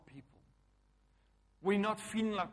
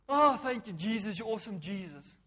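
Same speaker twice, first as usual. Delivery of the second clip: high, loud yawn easing into praise; praising through a yawn; 4 semitones higher, 7 dB louder than usual. Retold or imitated. Wife imitating husband's praise when he yawns, mock yawning. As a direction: yawn out the thanks, starting big and settling. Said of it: "Every time you yawn".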